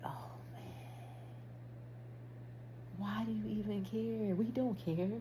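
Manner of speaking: resigned self-talk. Retold or imitated. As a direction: quietly talk yourself out of caring. Dismissive reply in self-talk.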